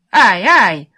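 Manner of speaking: two loud yelps; loud cries of pain. A narrator's reading. Prisoner covering an escape from the guard, loud yelping. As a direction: yell out "ouch" twice, loudly. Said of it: "поднимал крик".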